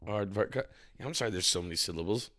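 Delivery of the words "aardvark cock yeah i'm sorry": ordinary talk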